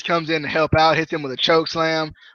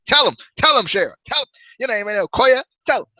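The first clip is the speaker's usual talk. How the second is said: high, punchy fan cheering; all hype; 7 semitones higher than usual. Retold or imitated. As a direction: urge your favourite wrestler on. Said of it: "gets all, gets all hype".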